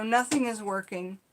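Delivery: ordinary talk, nothing marked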